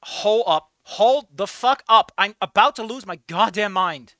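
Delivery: loud exasperated shout; angry outburst. Real, not acted. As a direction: blow up again. Erupting again.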